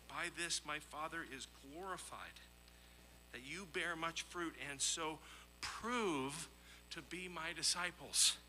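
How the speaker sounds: ordinary talk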